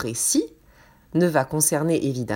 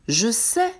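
slow, clear dictation voice; dictating. Acted. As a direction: read it out slowly word by word. Dictation read to the class.

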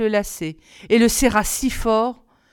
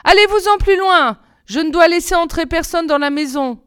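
much higher, louder cry from the window; anxious girl calling out to send someone off; 9 semitones higher, 6 dB louder than usual. A narrator's reading. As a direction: shout the stranger away through the window. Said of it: "Blanche-Neige regarda dehors et cria".

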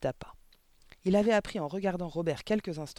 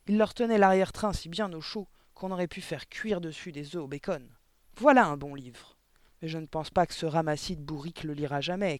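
harsh headmistress; 5 dB louder than usual. Acted. Headmistress berating a young teacher, loud scornful tirade. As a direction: berate her like a brute of a headmistress.

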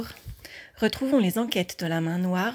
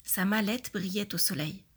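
ordinary delivery

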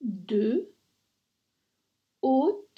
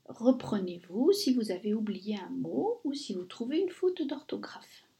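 ordinary talk

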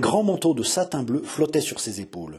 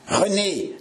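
higher-pitched, sharp call of a name; growled summons; 7 semitones higher than usual. A narrator's reading. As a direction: summon a servant with a scolding call. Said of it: "gronda Catherine". (appel grondé)

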